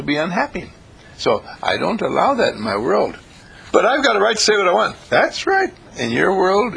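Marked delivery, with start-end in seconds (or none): 3.7-5.7 a higher, faster indignant objection; mock indignant listener. Imitating a listener who insists on a right to speak, higher and faster. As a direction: play the defiant heckler, quick and high.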